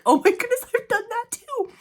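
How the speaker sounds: high-pitched squeal of recognition; excited recognition. Retold or imitated. Reader relating to heroine's odd thoughts, high excited recognition. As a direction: gush as if caught out and delighted. Acting out her delighted recognition.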